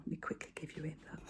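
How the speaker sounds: quick unvoiced whisper, rushing to wrap up; hurried whisper. Real, not acted. Vlogger rushing off camera as the cat eyes her table, whispering. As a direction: breathe it out fast before the cat gets there.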